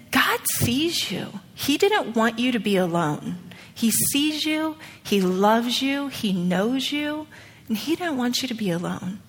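slow, soft words of comfort; hushed, unhurried sincerity; tender reassurance. Retold or imitated. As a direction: gently console someone grieving, spacing each phrase. Retold comforting of grieving stranger.

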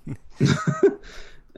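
squeaky high burst of laughter. Real, not acted. Laughing at antagonists joke.

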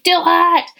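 high, loud pushy yell; shouted command, hammed up; mock shout. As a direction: bark it like a pushy coach. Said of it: "I'm not gonna be here to be like".

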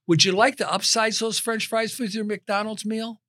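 chirpy drive-thru upsell; upselling cashier. Retold or imitated. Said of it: "if I would have got this script down".

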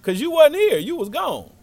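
high, loud mock girl's comeback; defensive retort. Girl telling the boy why she left, loud high retort. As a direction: throw it back high, dropping at the end.

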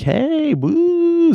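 high, squealed hey woo; mock cheery greeting. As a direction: imitate friends too perky for a hangover. Hungover, friends cheerfully calling out.

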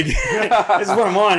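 alto e lento, ridendo; laughing, high voice. Retold or imitated. Laughing retold line.

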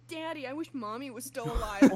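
cracking up through a movie line; laughing mock quote. Retelling a funny movie line, laughing loudly. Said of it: "the principal going".

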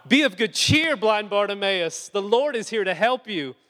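hammed-up crowd suddenly all kindness; fawning crowd. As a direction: sing it out sweet and fake. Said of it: "the fairies and the rainbows are out".

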